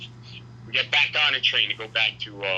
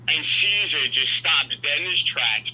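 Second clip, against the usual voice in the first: ordinary talk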